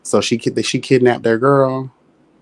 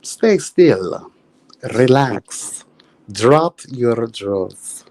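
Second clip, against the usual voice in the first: vampire trance voice. Pretend. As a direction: lull him into the trance. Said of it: "they go over to Odell Beckham".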